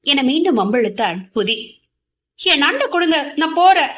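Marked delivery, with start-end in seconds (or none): 2.4-3.9 higher, louder, cross demand; glaring demand. Acted. Girl glares and demands her crab back, cross. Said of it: "என முறைத்தாள்".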